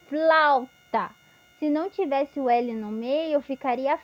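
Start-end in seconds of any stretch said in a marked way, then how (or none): 0.1-1.1 "Flauta" said louder and clearly, FL cluster crisp; clear demo word. Shown as a demonstration. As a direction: repeat "Flauta" firmly, crisp FL. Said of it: "não tenham vergonha".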